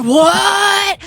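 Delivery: high, loud mock-astonished shout; hammed-up surprise; fake amazement. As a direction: overact the amazed ad sidekick. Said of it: "like, so fake".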